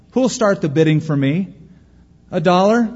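loud auctioneer calling for bids; auctioneer's cry. Acted. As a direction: drum up bids from the crowd. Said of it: "he cried".

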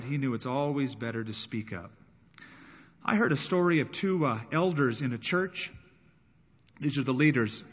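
ordinary talk, nothing marked

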